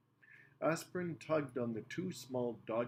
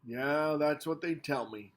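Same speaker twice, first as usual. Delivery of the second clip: bored; 3 semitones higher, 7 dB louder than usual. A narrator's reading. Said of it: "the driver said in a bored voice".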